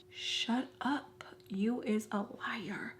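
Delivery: hushed mock whisper. Whispering insults about the boyfriend, hushed. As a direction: breathe the insult under your breath.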